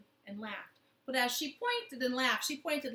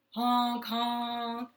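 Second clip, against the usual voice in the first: loud goose honking call; 6 dB louder than usual. Acted. Goose honks at the grabbing clown, loud honk. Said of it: "the goose yelled out".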